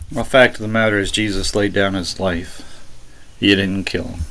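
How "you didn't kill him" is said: ordinary talk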